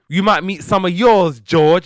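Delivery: high, loud retort; hammed-up sitcom put-down; sassy comeback. Louise firing back at George at the funeral, sassy.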